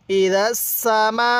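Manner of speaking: slow recitation, last vowel of সামা held very long; four-alif stretch. Shown as a demonstration. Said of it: "চার আলিফ টান দিব".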